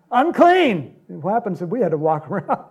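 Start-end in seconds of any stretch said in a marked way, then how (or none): 0.1-0.9 rising to a loud, high cry of Unclean; leper's shout. Retold or imitated. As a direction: shout it out to warn the crowd. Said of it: "you gotta shout".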